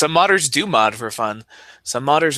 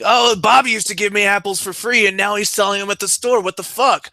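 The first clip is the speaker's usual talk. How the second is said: higher, louder mock-indignant Gabe Newell; 8 semitones higher, 4 dB louder than usual. Quoting Gabe Newell about free apples, mock indignant. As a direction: whine it like a cheated customer. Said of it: "Gabe Newell compared it to, like".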